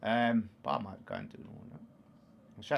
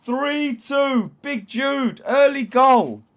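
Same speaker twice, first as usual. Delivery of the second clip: high, loud, far-off-sounding goal yell; goal celebration; 14 semitones higher, 15 dB louder, much slower than usual. Real, not acted. Streamer yelling as England score early. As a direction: scream it like the goal just went in.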